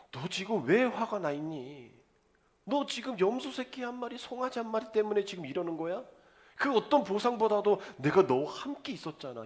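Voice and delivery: father's appeal, lightly acted; pleading father. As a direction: gently coax the sulking son. Father pleads with elder son.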